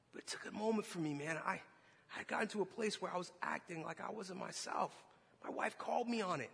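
ordinary delivery

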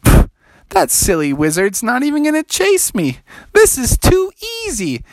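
high cocky taunt; smug frog. Acted. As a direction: sure he has got away. Froggy smug.

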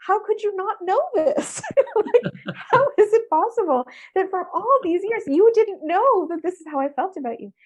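high-pitched retold disbelief, half laughing; amused, astonished protest; incredulous. Retelling her disbelief at mom's reaction.

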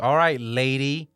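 slow, loud mock polite; drawn-out snide all right lady; mock condescending.

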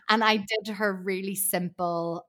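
normal talking voice

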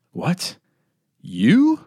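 breathless, rising shocked stammer. Acted. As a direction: gasp it out in disbelief. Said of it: "Between short, quick breaths, he stammered".

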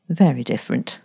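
ordinary delivery

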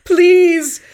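very slow, loud laughing wail; melodramatic plea. Retold or imitated. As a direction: collapse into hammy despair. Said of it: "Raul Julia just collapses".

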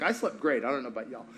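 low, soft, unbothered reply; nonchalant Jesus. Retold or imitated. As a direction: toss it off quietly, untroubled. Unbothered Jesus answers upset disciples.

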